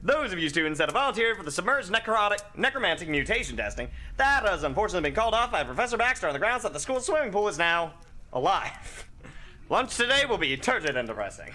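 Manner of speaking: bubbly morning announcer, high and bright at the start; perky radio announcer. Acted. [name] greets Miskatonic students as a cheery morning announcer. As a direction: chirp the announcements like a breakfast DJ.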